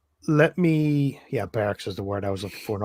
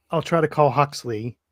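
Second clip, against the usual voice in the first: usual speaking voice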